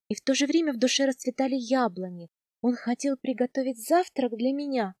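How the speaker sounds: ordinary delivery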